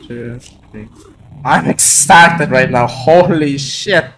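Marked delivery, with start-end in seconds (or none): 0.1-0.9 very soft, low count fading away; hushed counting. Real, not acted. Collector counting cards off the pack, hushed. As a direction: count under your breath, trailing to almost nothing.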